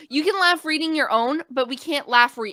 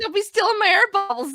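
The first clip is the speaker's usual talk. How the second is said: high laughing squeak; 8 semitones higher than usual. Real, not acted. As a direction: giggle the words out.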